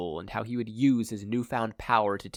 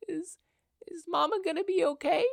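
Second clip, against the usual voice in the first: put-on small child's voice, much higher, whispered through tears; a scared little girl barely getting words out; sobbing whisper. Little sister whispers her fear into brother's shoulder, tearful. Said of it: "Eri sobbed into Izuku's shoulder".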